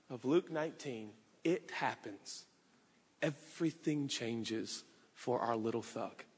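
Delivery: low, soft storytelling voice; hushed narration. Acted. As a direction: draw them into the scene quietly. Hushed storytelling of the turning point.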